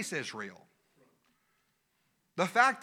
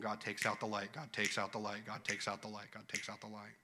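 fading repetition; 9 semitones lower, 11 dB quieter than usual. Real, not acted. Repeated line fading away.

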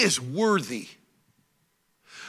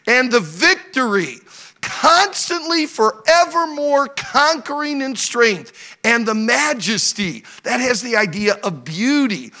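loud, high, stretched-out proclamation; rousing pulpit delivery, drawn-out key words; full-force preaching; 6 semitones higher, 9 dB louder than usual. Real, not acted. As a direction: hammer each attribute home to the congregation.

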